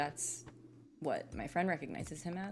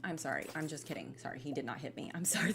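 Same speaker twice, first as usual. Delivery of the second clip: ordinary delivery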